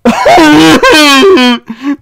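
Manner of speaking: a loud, high, disbelieving laugh that cracks; shrieking laugh of disbelief; hysterical laugh. Real, not acted. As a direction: burst out laughing in horror. Shocked at the book's result.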